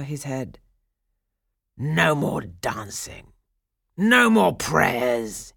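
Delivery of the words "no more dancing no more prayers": slow, drawn-out gloating pronouncement, building louder; triumphant pirate chief. A narrator's reading. Pirate chief holding girl over his head, slow triumph. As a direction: gloat slowly over the captive. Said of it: "held her triumphantly".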